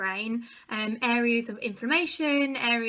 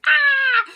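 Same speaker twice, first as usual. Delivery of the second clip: high, loud mock wail; meltdown wail; 14 semitones higher, 11 dB louder than usual. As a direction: re-enact breaking down with a scream. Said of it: "Completely had a massive meltdown".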